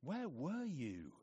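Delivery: slow, drawn-out reproach; complaint. Acted. Grieving sister to Jesus who came late, complaining. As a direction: complain with a plaintive, lingering question. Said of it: "And complaint".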